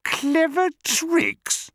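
very high, drawn-out disbelief; outraged little crocodile; incredulous cry. A narrator's reading. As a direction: shriek it back in scorn. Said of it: "cried the not-so-big one".

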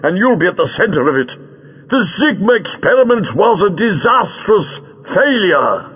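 high, forceful, insistent plea; urgent warning. A narrator's reading. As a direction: drive the danger home to a stubborn enemy. Doctor urgently warns Greel.

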